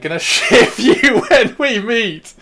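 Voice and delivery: high, loud, drawn-out teasing outburst; laughing mock threat. Real, not acted. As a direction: stretch it out through a laugh. Teasing threat to a chat friend.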